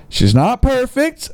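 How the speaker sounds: high, slow lilting read of the seller's line; mock doting owner. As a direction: read it like he's doting on his girl. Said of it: "Oh boy, he's calling her by she".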